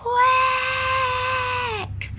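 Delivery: a far-off little duck quack; faint distant quack. Acted. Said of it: "a faint and distant quack".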